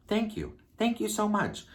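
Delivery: high falsetto gratitude; emphatic thanks. Retold or imitated. Retold emphatic thanks for validation.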